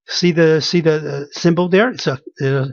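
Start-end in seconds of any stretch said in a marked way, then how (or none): none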